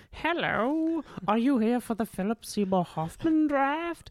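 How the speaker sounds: high, theatrical fantasy stranger; lightly voiced mysterious hooded man.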